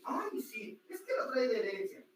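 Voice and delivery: high-pitched mock agreement; mocking what everyone says. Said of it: "Y todos dicen".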